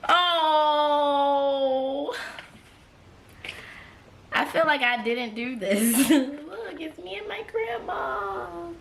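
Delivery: high voice cracking with tears; moved to tears by the photo; real crying, voice breaking; tearful. Real, not acted. Sees photo with grandma.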